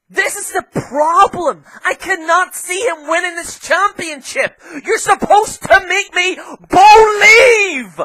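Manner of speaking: high, building to a drawn-out furious shout; angry rant. Real, not acted. Wrestling pundit raging at bookers over an unbelievable champion, angry. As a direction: let exasperation explode into a real yell. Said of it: "I'm sorry I'm so angry".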